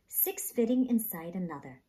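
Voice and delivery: usual speaking voice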